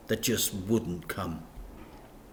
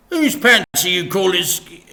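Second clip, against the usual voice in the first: higher, louder, offended; indignant Dad; 10 semitones higher, 12 dB louder than usual. A narrator's reading. Dad defending his underpants, indignant. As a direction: cut off mid-protest.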